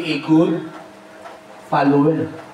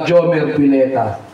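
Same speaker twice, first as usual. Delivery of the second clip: normal talking voice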